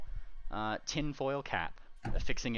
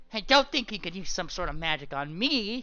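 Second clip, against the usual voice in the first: smug paranoid; 8 semitones higher than usual. Acted. Paranoid pony speaks.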